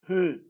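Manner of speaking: "he" breathed out firmly on the h; aspirated strong E. Shown as a demonstration. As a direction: demonstrate "he" as a strong aspirated syllable. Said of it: "pero que se aspira".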